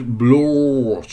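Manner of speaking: Blue said as "blaw" in South African accent; a loud South African accent on Blue. Shown as a demonstration. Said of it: "saying it with the South African accent".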